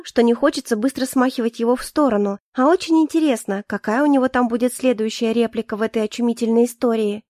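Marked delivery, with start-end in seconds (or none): none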